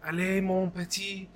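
high-pitched French chef coaxing his pastries; sing-song French accent, cheering the pastry on; French chef impression. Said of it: "He was like".

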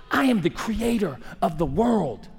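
usual speaking voice

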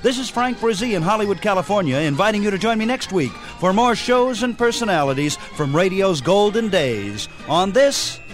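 usual speaking voice

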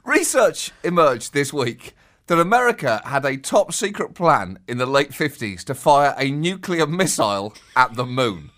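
ordinary talk

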